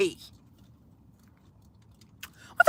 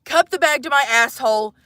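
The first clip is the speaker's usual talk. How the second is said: ordinary talk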